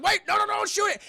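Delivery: loud, high frantic begging; panicked plea. Retold or imitated. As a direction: yell to stop them shooting the rental car. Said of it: "He's like".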